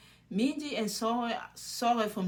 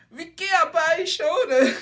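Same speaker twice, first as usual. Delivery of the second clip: mock gushing; 8 semitones higher, 12 dB louder than usual. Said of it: "She's like".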